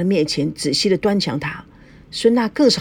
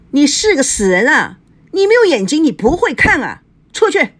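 much higher and louder, screaming at the maid; hysterical yelling; 7 semitones higher, 5 dB louder than usual. A narrator's reading. Mother screaming at the maid to get out, hysterical. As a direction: lose control and scream her out. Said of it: "叶枫歇斯底里地大叫".

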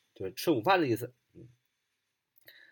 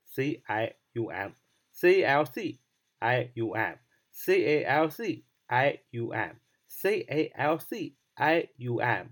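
normal talking voice

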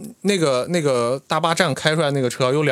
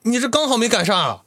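mock-anxious tout, pitched up; imitating urgency; 10 semitones higher than usual. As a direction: hurry him like a pushy tout. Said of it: "那人就特别着急说". (模仿骗子着急的语气)

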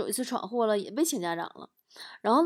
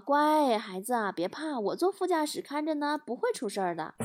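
reassuring father; 4 semitones higher, 5 dB quieter than usual. Acted. Dad coaxes son about mum driving, soft reassurance. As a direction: soothe a frightened child gently.

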